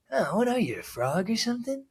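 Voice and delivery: high, sinister villain sneer; super villain voice. Retold or imitated. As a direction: play it like a comic book villain. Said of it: "like a super villain".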